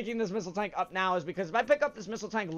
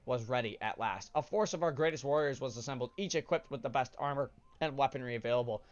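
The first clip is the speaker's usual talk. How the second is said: a lightly solemn, quieter storybook read; lore reading; 5 semitones lower, 3 dB quieter than usual. Acted. Gamer reading scanned lore to viewers, subdued narration. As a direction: read the lore like an old chronicle, calm and low-key. Reads in-game lore aloud.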